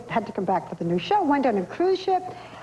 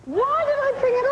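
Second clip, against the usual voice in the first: exasperated high wail; 14 semitones higher than usual. Real, not acted. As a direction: groan it out, higher the second time. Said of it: "regret, repeated".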